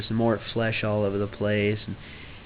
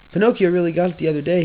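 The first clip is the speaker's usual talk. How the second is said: high, loud, fast retake of the voice; second go at Geppetto's voice, quicker; retried accent; 8 semitones higher, 5 dB louder, faster than usual. Acted. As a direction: rush the line again in a fresh put-on accent. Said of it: "I'm doing the wrong accent for this".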